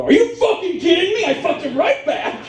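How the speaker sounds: high, indignant parroted outburst; mock outrage. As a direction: throw the outraged line back at him. Mimics the man's outraged line back.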